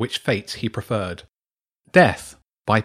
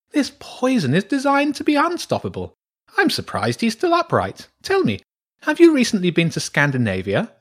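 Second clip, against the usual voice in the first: high-pitched, knowing badger; intrigued badger priestess; 12 semitones higher than usual. Badger priestess questioning a poisoned boy, intrigued. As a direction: explain and probe in a raised creature voice.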